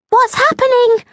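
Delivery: very high, anxious tiny fairy call; worried little fairy squeak; Amber, worried. A narrator's reading. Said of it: "She sounded worried".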